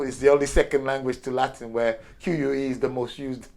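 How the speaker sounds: ordinary talk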